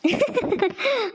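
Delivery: a short high laugh, amused. Acted. Woman laughs at her friend's husband story, high and amused. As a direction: laugh at the stingy husband joke.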